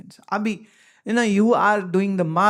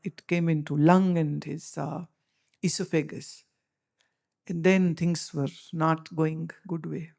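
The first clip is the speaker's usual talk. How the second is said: ordinary delivery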